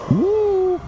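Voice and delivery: high whooping cheer; excited whoop. Real, not acted. Gamer cheering after a narrow escape, whooping high. Clearing a hard room.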